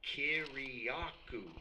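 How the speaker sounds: Kiriakou said slowly, syllable by syllable; Kiriakou, slow and deliberate; name said slowly. Shown as a demonstration. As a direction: spell Kiriakou out slowly with your voice. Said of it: "just like it's spelled".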